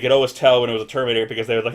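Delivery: usual speaking voice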